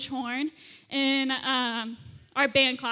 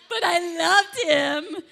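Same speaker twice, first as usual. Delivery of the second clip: mock heartbroken; 3 semitones higher, 5 dB louder, slower than usual. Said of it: "I've heard that one before".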